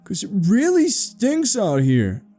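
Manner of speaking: high, drawn-out disgusted complaint; boy protesting a bad smell; high-pitched, insistent kid disgust; demanding. A narrator's reading. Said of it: "Ralphie demanded".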